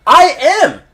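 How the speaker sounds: heated promo. Acted. Champion shouts down his critics in a heated promo. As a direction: build the promo up, pitch climbing.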